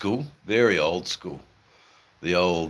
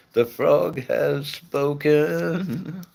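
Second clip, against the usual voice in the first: higher, very slow pompous proclamation, The frog has spoken; mock grand announcer; 10 semitones higher, 3 dB louder, much slower than usual. Announcing a viewer's verdict, in a hammed-up oracle voice.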